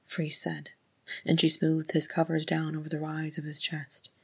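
plain reading voice

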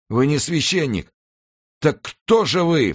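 high, horrified questioning; alarmed cry. A narrator's reading. Executioner learns monk is no priest, high alarmed cry. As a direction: cry out as the truth starts to dawn. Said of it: "вскричал палач".

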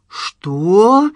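loud, rising disbelief; incredulous. A narrator's reading. Professor staring at his nephew, incredulous. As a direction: stretch it upward in disbelief. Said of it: "недоверчиво уставился на племянника".

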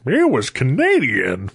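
slow, high-swooping mock old man; loud old man. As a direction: imitate an old man announcing it.

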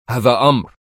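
stern, commanding interruption. A narrator's reading. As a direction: cut in with a firm order. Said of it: "قاطعها في صرامة شديدة". (صرامة شديدة)

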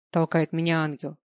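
plain reading voice

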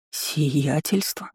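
slow, soft, puzzled rising question; baffled echo. Acted. Heroine echoing the strange title back, slow and puzzled. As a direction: drag the word out in quiet disbelief.

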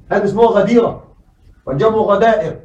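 ordinary talk, nothing marked

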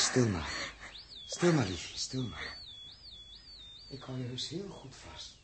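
put-on soft, soothing hush to a sick child; gently calm her down; tender comforting. Captain soothing feverish Els, softly and tenderly.

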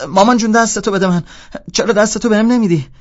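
very high, loud wail to mummy; mock blind pleading. Playing blind to annoy the roommate, loud mock pleading. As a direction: beg like a hammed-up lost child. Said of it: "داشتم مسخره بازی در میآوردم".

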